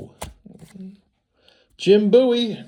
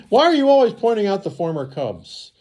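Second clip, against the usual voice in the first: higher, quicker teasing voice of an imagined viewer; mock heckler; 7 semitones higher, 3 dB louder, faster than usual. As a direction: needle yourself like a pestering fan.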